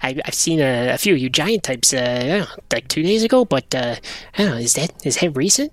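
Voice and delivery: hesitant, rambling creature with jumpy pitch; furtive little creature. Acted. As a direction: dither and stammer, puzzling over the question.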